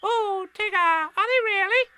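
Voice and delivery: put-on very high, tiny squeaky child-animal voice; little Roo. A narrator's reading. Roo questioning Tigger, high squeaky little voice. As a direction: squeak it like a curious baby kangaroo.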